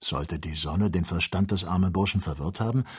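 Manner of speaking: ordinary delivery, nothing marked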